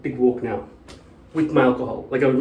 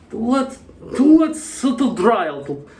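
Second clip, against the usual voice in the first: put-on German accent; 9 semitones higher than usual. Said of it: "I can't do the accent".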